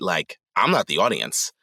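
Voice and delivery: usual speaking voice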